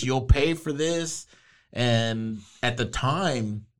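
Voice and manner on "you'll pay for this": slow, higher fist-shaking threat; hammed-up villain vowing revenge; sputtering foiled villain. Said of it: "he's shaking his fist".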